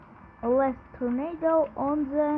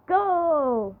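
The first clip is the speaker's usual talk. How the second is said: shouting at the game; 6 dB louder, slower than usual. Real, not acted.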